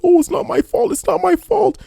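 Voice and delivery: hammed-up whiny protest; mocking whiny streamer. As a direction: squeal it like a sulking kid. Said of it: "trying to be like".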